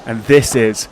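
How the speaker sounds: dramatic pause before the show title; show announcer. Acted. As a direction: announce the podcast name.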